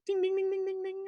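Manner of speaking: high tinkling ding-ding of a shop bell; bell chime. Acted. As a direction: chime it like a tiny doorbell. Door bell imitated.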